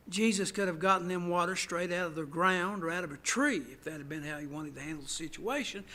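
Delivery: ordinary talk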